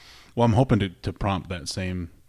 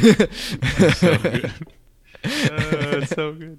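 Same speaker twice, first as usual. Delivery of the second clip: laughing; 9 semitones higher, 9 dB louder, much slower than usual. Real, not acted. Laughing at the impressions.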